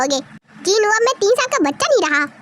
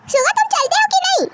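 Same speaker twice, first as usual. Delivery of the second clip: impatient call; 10 semitones higher than usual. Acted. Wife calls husband impatiently.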